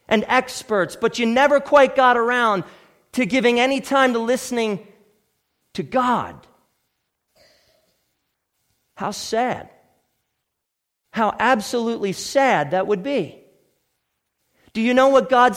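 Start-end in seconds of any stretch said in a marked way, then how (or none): none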